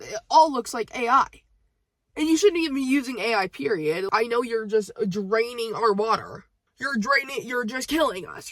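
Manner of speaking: loud, high-pitched outburst of protest; exasperated rant. Real, not acted. Venting at the man over his AI image, exasperated. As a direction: scold at full volume, pitch up.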